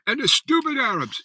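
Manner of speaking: high-pitched mock tirade; imitated ranting friend. Imitating his friend dissing everyone, high ranting caricature. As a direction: rant in a voice pushed far up, caricaturing a complainer. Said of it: "And he's always going".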